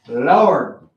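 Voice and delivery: ordinary delivery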